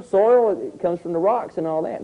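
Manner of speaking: ordinary talk, nothing marked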